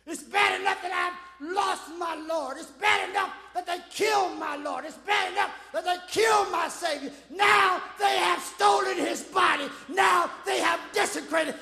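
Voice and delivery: acted grief in a preacher's ringing cadence; preached weeping lament. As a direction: mourn it in repeated, building phrases. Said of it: "she is weeping and she is crying".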